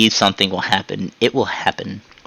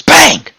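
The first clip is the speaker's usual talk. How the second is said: high, loud mock gunshot; imitated gunshot; 6 dB louder than usual. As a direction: fire a sudden pretend shot with your voice. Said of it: "guardsman goes up and goes".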